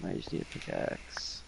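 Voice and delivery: very soft whisper to self; whispered aside. Real, not acted. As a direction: whisper it to yourself. Muttering to self.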